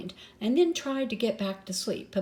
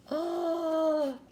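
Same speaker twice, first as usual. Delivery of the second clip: high gasp; 9 semitones higher than usual. Acted. Waterfall plunge.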